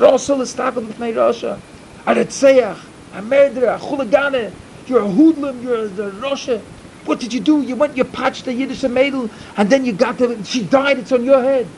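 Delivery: angry rebuke. Acted. Rebbe denouncing a sinner who came for help, angrily. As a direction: hurl each accusation at him.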